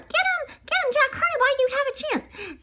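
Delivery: very high, fast panicked squawk; terrified yell. Acted. Duck hiding behind the bear, terrified high yell. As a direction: shriek it like a scared duck hiding. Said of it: "shivering and yelled".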